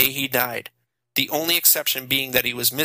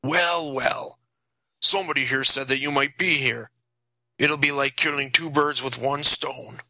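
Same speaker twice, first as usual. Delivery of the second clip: lightly voiced gloating vampire; a hint of a monster nearly laughing; the vampire gloating at Jason, mildly amused; inhuman amused voice. A narrator's reading. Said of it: "nearly laughing".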